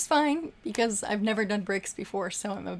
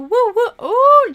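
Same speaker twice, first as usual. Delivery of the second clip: high, loud whooping cheer; excited whoops; 13 semitones higher, 14 dB louder, slower than usual. Real, not acted. Streamer whooping at a big super chat, loud and high.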